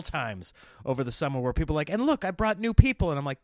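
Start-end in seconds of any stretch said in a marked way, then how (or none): 1.9-3.1 raised, louder, eager announcement; high and loud, mock-eager; excited fan. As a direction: imitate a fan proudly showing off. Fans proudly bringing newcomers.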